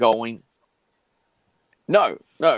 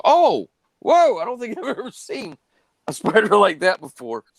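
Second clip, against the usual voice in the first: surprised; 12 semitones higher, 4 dB louder than usual. Retold or imitated. Wife's surprised reaction quoted.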